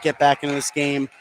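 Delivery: ordinary delivery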